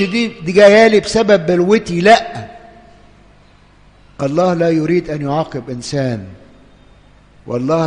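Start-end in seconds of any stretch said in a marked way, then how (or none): 0.0-2.0 loud, higher complaint of someone blaming his misfortune; self-pitying believer. Retold or imitated. Preacher mimics a believer blaming his suffering, loud and higher. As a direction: voice the man sure he's being punished. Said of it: "ما تقولش".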